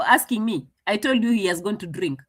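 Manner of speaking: normal talking voice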